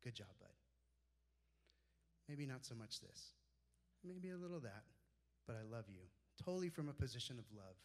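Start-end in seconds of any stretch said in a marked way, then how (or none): 0.0-6.1 gentle mentor. Retold or imitated. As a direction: lower and softer, loving correction.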